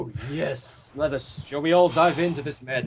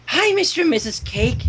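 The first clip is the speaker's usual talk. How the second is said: higher, bubbly cartoon pony voice; Pinkie Pie voice; 10 semitones higher than usual. Pinkie greeting the Cakes, higher bubbly pony voice. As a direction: chirp it like an eager pink pony. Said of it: "get my Pinkie Pie voice".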